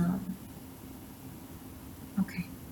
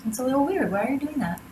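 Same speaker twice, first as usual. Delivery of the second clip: pitched higher with a falling end, mock disbelief; incredulous; 7 semitones higher, faster than usual. Shown as a demonstration. Reader acting out shock at a line-cutter, incredulous. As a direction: act baffled by someone cutting ahead. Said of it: "repeated with different pitch".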